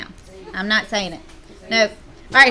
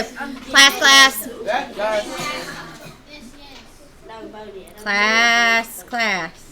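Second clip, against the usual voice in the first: calling the class; much slower than usual. Real, not acted. Teacher calls class to attention.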